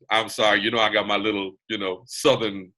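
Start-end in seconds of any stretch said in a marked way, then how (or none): none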